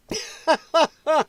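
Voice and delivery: high run of chuckles; sympathetic laughter. Real, not acted. Streamer hoping injured viewer is okay, high chuckling. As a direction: laugh in a quick, high burst.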